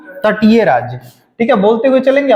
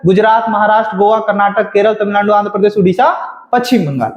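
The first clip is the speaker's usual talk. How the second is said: Gujarat to Paschim Bengal rattled off without pauses; quick-fire memorised list, dropping at the end; rapid recitation. Shown as a demonstration. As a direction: run the list of states together at speed. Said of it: "ये speed होना चाहिए".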